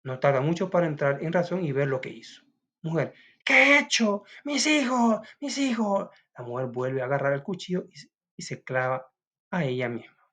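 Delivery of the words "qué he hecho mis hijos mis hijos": higher, louder anguished lament; anguished cry. Acted. Mother grasps she killed her children, higher, louder anguished cry. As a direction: wail over the lost children.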